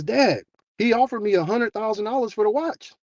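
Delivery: high-pitched amazed report to Dad; blown-away daughter. Retold or imitated. Daughter telling her dad of the huge offer, blown away. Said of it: "the daughter's just blown away".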